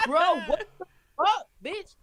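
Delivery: high-pitched mock disbelief; retold exasperation, pitch leaping up; incredulous outrage. Confronting the friend who gave away her name, incredulous.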